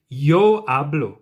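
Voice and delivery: slow, emphatic Yo hablo with weight on Yo. Shown as a demonstration. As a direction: stress Yo to claim the floor. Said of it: "me identifying that I am speaking".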